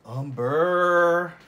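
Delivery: UMBER drawn out, high and very loud; exaggerated word echo. Painter announces the paint colour, with "Umber" loud, high and drawn out. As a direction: boom "Umber", stretching the last syllable. Playfully echoes the paint name.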